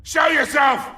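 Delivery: loud angry yell at the intruder; furious shouting. Acted. Mob boss shouting in fury at the masked intruder. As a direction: bellow it with rage.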